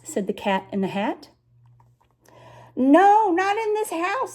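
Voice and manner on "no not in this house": fussy fish. A narrator's reading. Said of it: "said the fish in the pot".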